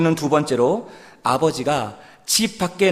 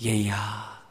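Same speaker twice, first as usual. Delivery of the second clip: soft, lower fatherly call; tender call; 6 semitones lower, 7 dB quieter, much slower than usual. Retold or imitated. Father gently calling his angry elder son, soft and tender. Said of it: "이렇게 부르는 겁니다".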